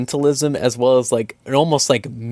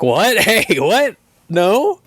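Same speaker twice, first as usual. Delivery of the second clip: higher, puzzled mock questions; confused; 9 semitones higher, much slower than usual. Player asking villagers in higher puzzled voice. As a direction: play dumb and baffled. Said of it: "And you're like".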